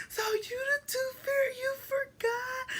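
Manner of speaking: mock tearful kid; crying accusation. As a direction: sob it out at mom.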